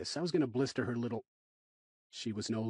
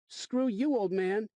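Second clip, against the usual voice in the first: put-on higher, louder snotty kid voice; a mouthy boy sneering at a grown-up; bratty kid; 10 semitones higher, 4 dB louder, slower than usual. Rude kid insulting narrator.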